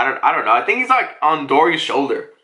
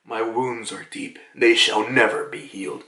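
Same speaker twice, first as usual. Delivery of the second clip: hammed-up solemn murmur; trying to be deep; 6 semitones lower, 4 dB quieter than usual. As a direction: play a brooding man being profound. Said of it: "trying to be deep".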